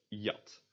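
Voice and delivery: "jat" begun like English Y in you; Dutch J. Shown as a demonstration. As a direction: say "jat" as "yat". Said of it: "like the English Y".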